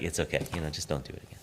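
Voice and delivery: low, very soft and forgiving; retold gentle reassurance. Teacher forgiving tearful student, retold gentle reassurance. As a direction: let them off quietly, voice dropped right down.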